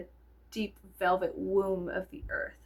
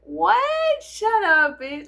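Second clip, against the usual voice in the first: high, loud mock outrage; playful shock; 12 semitones higher, 9 dB louder, much slower than usual. To her husband after a compliment: high loud mock outrage. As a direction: squeal it, flattered and scandalised. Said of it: "And I was like".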